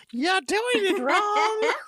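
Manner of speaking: lady in the woods scolding, hammed up; mock scolding lady. Woods lady yelling at the boys, high and slow.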